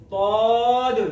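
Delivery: तौद said thick and emphatic; heavy emphatic toad. Shown as a demonstration. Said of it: "और इसको पढ़ना है". (तौद भारी मोटी आवाज़ में)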